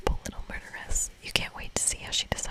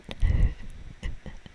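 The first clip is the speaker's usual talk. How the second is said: whispered laugh; 4 dB louder than usual. Real, not acted. Reacts to character getting caught.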